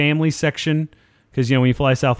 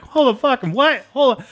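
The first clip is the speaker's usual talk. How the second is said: much higher and strained, shocked; stunned disbelief; 11 semitones higher than usual. Retold or imitated. Hearing Iron Man died while boarding, a high, stunned retort. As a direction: recoil at news you can't accept. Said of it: "I'm like".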